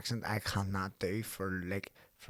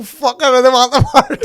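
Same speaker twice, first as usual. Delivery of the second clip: high, loud throwing-in-the-towel cry; hammed-up shouted refusal; mock outburst; 18 dB louder than usual. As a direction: blow up and quit the audition. Acts out storming out of audition.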